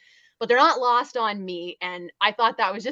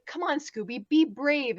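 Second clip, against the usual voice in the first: lightly voiced pep talk, pitched higher; mock encouragement, lifted pitch; coaxing Freddy; 6 semitones higher than usual. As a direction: urge on a scared friend in a higher voice. Freddy coaxing a frightened Scooby.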